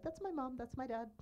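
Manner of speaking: high-pitched, insistent retold protest; pleading protest. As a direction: voice jumps up high, reminding them who these people are. Voicing own protest to viewers.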